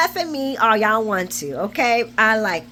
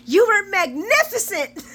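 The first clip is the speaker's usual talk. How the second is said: high, laughing exaggerated praise; hammed-up swooning compliment; mock gushing; 10 semitones higher, 4 dB louder than usual. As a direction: gush it, pitched way up. Acting out her gushing praise.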